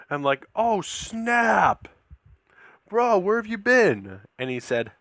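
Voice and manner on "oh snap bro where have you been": higher, starstruck exclamation; excited greeting. Retold or imitated. Fan greeting the actor, retold with excitement. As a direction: act out a thrilled fan's greeting.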